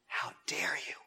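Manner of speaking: breathless unvoiced whisper; whispered rebuke. Confronting the heckler, a whispered how dare you. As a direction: hiss it under your breath as a rebuke.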